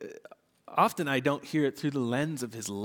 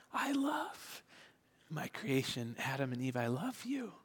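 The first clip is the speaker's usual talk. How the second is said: whisper; 8 dB quieter than usual. Acted. Voicing God loving Adam and Eve.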